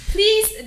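high, stressed please; emphatic plea. Real, not acted. Host urging listeners about the silent letters, emphatic please. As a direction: lean hard on please. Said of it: "the G H in this word is silent".

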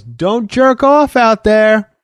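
high, drawn-out call from another room; mock holler through to the next room, stretched out; retold yell, pitched well up and slowed; imitated distant yell. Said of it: "he yells from the other room".

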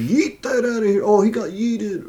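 high, bright gamer hype; excited gamer voice. Retold or imitated. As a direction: shout it like a hyped gamer. Imitating Fortnite gamers.

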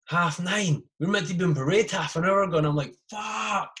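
high, loud panicked yelp; the horror of oversleeping parade. Retold or imitated. Soldier realising he's late, panicked high yelp.

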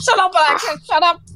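telling the speaker off, hammed-up; imitating yelling at Alexa; mock snap at Alexa. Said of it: "And goes".

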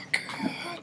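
breathless whispered fuck after the sip; whispered curse. Real, not acted. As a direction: hiss a curse under the breath. Reacting to the drink.

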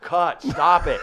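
loud mock yell; mock shout. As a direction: bark it like a director. Acted shout in retold sketch.

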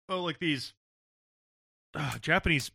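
words pushed out around a burp; talking while burping. Real, not acted. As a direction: burp caught mid-sentence. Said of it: "Sorry, I'm burping while trying to talk".

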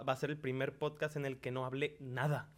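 ordinary talk, nothing marked

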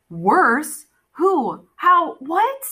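put-on a frightened boy firing questions; panicky.

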